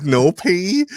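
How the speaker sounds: high, loud rising retold question; emphatic mock question. Retelling the no-pee rule before dinner, emphatic mock question. Checks the rule, acted insistence.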